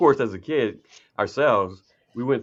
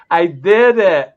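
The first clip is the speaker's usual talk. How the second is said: put-on kid voice; 6 semitones higher, 12 dB louder, slower than usual. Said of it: "like our kids would say".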